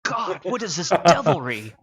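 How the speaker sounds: a hammed-up jump of fright; startled cry. Old miner startled by a figure behind him, loud. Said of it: "He just goes".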